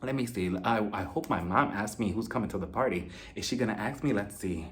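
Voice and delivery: sneaky whispered-thought voice; scheming inner voice. Retold or imitated. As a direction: plot it quietly to yourself, low and soft. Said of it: "in her head, she was just like".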